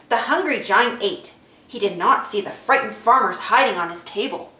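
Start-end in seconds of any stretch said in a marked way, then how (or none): none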